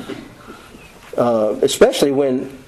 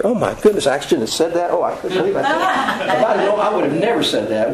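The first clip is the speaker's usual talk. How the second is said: embarrassed stranger; 4 semitones higher than usual. Retold or imitated. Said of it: "and they go".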